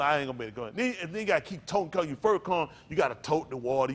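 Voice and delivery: ordinary talk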